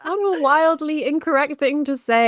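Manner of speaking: loud, high exclamation of amused disbelief; mock outrage. Co-host reacting to the cheating comment, mock-appalled exclamation.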